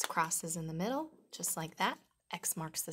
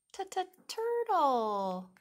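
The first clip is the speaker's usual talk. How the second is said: excited reveal; 9 semitones higher, 5 dB louder, slower than usual. Acted. High, loud reveal of the turtle.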